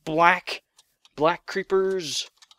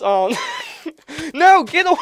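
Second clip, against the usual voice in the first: loud, high, giggly panic; panicked shout; 11 semitones higher, 7 dB louder than usual. Real, not acted. Gamer swarmed by creatures, panicked shriek. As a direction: shriek at them to back off.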